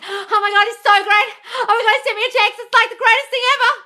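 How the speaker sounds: high, loud, breathless gushing with a laugh; mock fangirl gushing. Mocking a girl thrilled by his text, shrill gush. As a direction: ham up a starstruck girl over a text.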